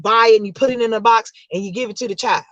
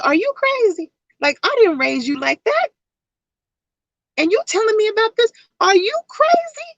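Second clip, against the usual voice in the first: rising higher with each question; old lady scolding in disbelief; high incredulous grandma scolding; scolding great-grandmother; 11 semitones higher than usual. Retold or imitated.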